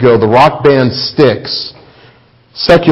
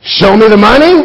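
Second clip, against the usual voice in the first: mocking question; 7 semitones higher, 3 dB louder than usual. Mocking worldly request.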